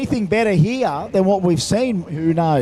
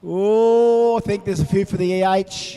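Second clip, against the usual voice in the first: announcer; 5 semitones higher than usual. Acted. Hosts crowd vote on burnouts.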